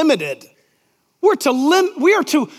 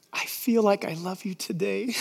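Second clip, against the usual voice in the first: much softer, dreamy bride voice; bride's vow; 4 semitones lower, 11 dB quieter than usual. Retold or imitated. Bride at the altar telling the groom, soft and dreamy. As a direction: breathe it to the groom like a fickle bride.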